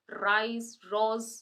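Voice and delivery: ordinary talk, nothing marked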